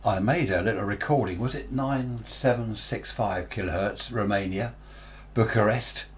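normal talking voice

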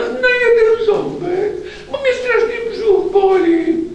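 very high, slow tearful pleading; whiny octopus. A narrator's reading. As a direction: whimper and beg like a hungry monster. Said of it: "płaczliwy jak we śnie".

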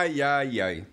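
normal talking voice

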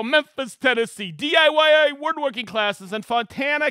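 loud, high announcer shout-outs; excited host roll call. Acted. Host hailing viewers watching from around the world, excited roll call. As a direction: call out each place like a rowdy emcee.

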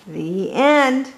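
ordinary delivery

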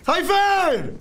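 high, loud yell of a name; shouting a name. Real, not acted. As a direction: call out across the map. Yells teammate's name mid-fight.

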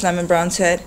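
ordinary delivery

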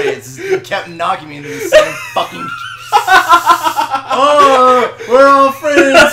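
loud, cracking up mid-sentence; laughing shout. Real, not acted. Friend knocked him off the ledge, laughing loud. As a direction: yell it through helpless laughter.

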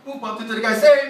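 normal talking voice

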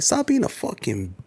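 mock scornful rebuke. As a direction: imitate dismissing a friend with scorn. Retold rebuke to struggling friend.

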